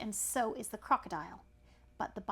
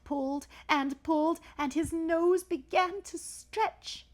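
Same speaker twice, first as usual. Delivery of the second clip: rising, louder with each pull; straining; 6 semitones higher, 7 dB louder, slower than usual. A narrator's reading. Storyteller on the elephant's child pulling, rising strain. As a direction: strain as if tugging, stretching the last words. Tug of war, voice straining along.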